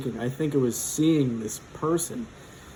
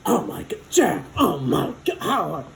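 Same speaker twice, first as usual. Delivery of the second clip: imitating excited recognition; 4 semitones higher than usual. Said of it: "And she's like".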